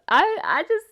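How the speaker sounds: loud, high, laughing through the words; real helpless laughter. Real, not acted. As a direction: barely get it out for laughing. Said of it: "this bitch keep making me laugh".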